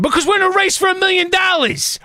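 exasperated outburst; heated rant. Real, not acted. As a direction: shout the reason at them. Ranting about cab drivers.

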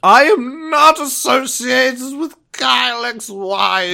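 slow, high wizard tone. Pretend. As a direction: answer like a solemn old wizard. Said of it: "That's what wizards talk like".

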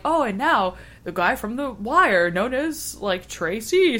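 slow, drawn-out stage announcement; announcer intro. Retold or imitated. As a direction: introduce him like a hype MC. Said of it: "they were like".

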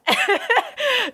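high burst of laughter. Real, not acted. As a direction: laugh out at the absurdity. Laughs at her breaking in.

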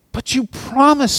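hint of a wounded young man; betrayed youth. A narrator's reading. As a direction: cry out in hurt disbelief.

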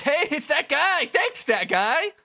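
high, loud, cheery shout; excited Fret. Acted. Fret thanking the host he hardly knows, excited. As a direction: hail him at a high pitch.

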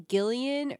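Gillian with a hard G, GIL-ee-un; hard-G pronunciation. Shown as a demonstration. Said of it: "heard her name pronounced two ways".

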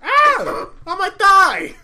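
very high, very loud yelp; re-enacted scream. Re-enacting the yell over his thigh, high scream. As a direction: scream it out, far above your speaking pitch.

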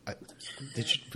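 ordinary delivery

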